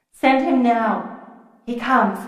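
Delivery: hazy, processed mind-voice; urgent words sent mind to mind; telepathic exchange. A narrator's reading. Fiona and her queen talk in thought, telepathic. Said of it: "the act of reaching out".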